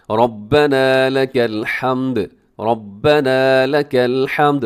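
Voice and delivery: slow recitation with a heavy, thick र in रब्बना; tajweed demonstration. Shown as a demonstration. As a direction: chant रब्बना with a full, dark r. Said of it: "रब्बना में रॉ मोटा है".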